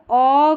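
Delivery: "ओ" as a drawn-out aw; long aw sound. Shown as a demonstration. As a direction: stretch "ओ" into a long rounded aw. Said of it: "लंबा उच्चारण".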